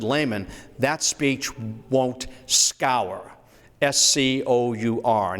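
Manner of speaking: slow, spelling out the word; slow rueful quote. Retold or imitated. Lincoln tells his bodyguard the speech failed, slow and rueful. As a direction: spell the word out slowly, disappointed. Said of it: "he said".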